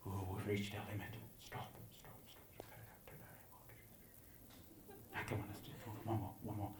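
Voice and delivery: whispering couple. Acted. Couple at auction whispering about their limit, hushed whisper. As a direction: whisper it like bidders conferring at the back. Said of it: "They're whispering over here".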